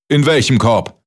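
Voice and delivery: high-pitched, pressing interrogation; irate giant. A narrator's reading. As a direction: grill the little liar indignantly.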